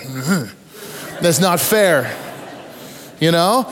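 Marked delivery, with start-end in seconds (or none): 0.0-2.1 half-laughing "That's not fair." at normal pitch; a preacher still grumbling decades later; wry grumble. Real, not acted. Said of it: "I'm kinda going".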